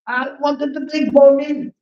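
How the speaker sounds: put-on a toddler sulking over lost milk; baby Krishna complaining that his mother put him down; angry child Krishna. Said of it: "Krishna becomes angry".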